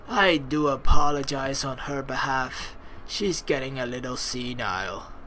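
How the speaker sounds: grandpa voice. Acted. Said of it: "roll with the grandpa voice".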